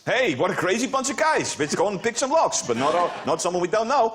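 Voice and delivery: mock lockpicker. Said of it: "These guys".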